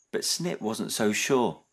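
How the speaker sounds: animated storytelling with lively character voices; children's story reading. A narrator's reading. Narrator telling children about Snip's dad, animated storytelling. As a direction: read a picture book aloud with expressive swoops. Reads a picture book to children.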